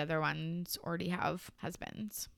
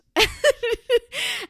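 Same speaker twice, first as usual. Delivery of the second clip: loud burst of laughter; 9 dB louder than usual. Real, not acted. Host cracks up rereading her old episode notes, loud laugh.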